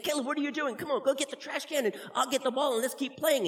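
put-on a young boy urging his brother on; kid brother voice. Little brother voiced in story.

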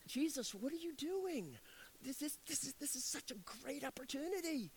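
a lightly voiced disciple, puzzled; wondering disciples. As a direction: disciples quietly question Jesus. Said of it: "I can only imagine his disciples, just wondering".

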